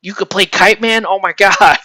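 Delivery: thrilled disbelief, playfully exaggerated; hyped-up fan. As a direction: gush in mock amazement. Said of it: "just like Cameron is like".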